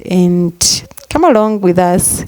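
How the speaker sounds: ordinary talk, nothing marked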